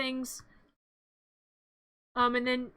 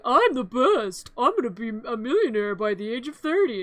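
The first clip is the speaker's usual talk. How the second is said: smug Gerald; 2 semitones lower than usual. Retold or imitated. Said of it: "And Gerald's like".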